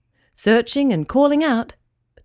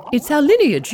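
higher, busy little fish voice; fussy fish voice; 6 semitones higher, 3 dB louder than usual. Acted. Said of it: "Palala and Glugli bob-blobbed together".

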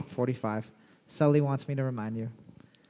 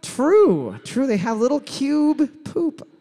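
silly put-on voice; 7 semitones higher, 4 dB louder than usual. Quizmaster in a silly voice.

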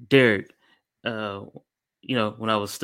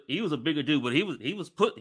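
usual speaking voice

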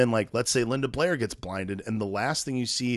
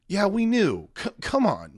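mock sarcasm; 9 semitones higher than usual. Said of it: "I was like".

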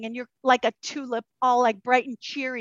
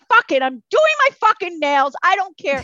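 defiant; 5 semitones higher, 6 dB louder than usual. Retold or imitated. Defiant self-care decision.

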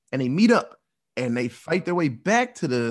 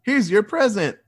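mock menacing mobster; 8 semitones higher, 4 dB louder than usual. Mobsters greeting him with gunfire.

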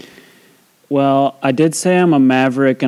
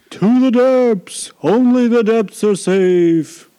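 high, slow whale call; 10 semitones higher, slower than usual. Acted. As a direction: bellow it like a wailing whale. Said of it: "This is my whale voice".

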